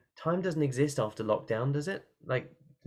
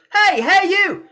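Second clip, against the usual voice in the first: loud, high call to the distance; 10 dB louder, slower than usual. Shown as a demonstration. Coach calls to a faraway thing in the field. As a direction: call to the furthest tree in the field. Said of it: "call to it".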